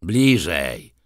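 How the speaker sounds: hoarse, commanding call. A narrator's reading. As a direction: bark it hoarsely at the strongman. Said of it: "woła ochrypłym głosem dyrektor".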